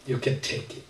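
a man giving up, barely audible; quiet surrender. A narrator's reading.

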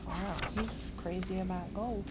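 plain reading voice

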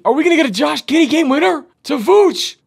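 high, loud shouting toward a game winner; frantic anticipation. Real, not acted. Commentator awaiting a Giddey game winner, frantic anticipation. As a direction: shout it in mounting suspense.

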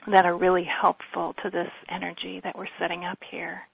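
ordinary delivery

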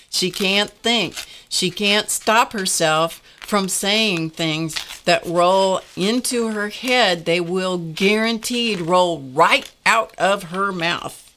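slowed down, each phrase leaned on; deliberate, heavy pacing; slow emphatic. Real, not acted. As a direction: spell it out slowly like a warning.

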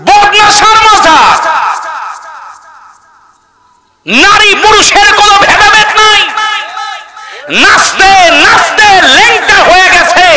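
high-pitched preacher's outcry; derisive shouting. Real, not acted. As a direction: cry it out high, ridiculing the fake shrines. Ridicules fake shrines before the crowd.

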